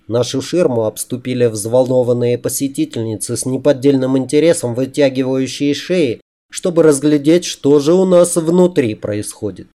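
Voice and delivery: plain reading voice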